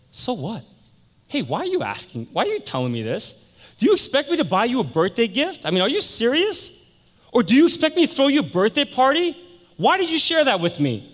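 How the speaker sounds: irritated retort building to loud, high outrage; indignant retort. Retold or imitated. Woman scolds the one sharing her birthday, indignant and building.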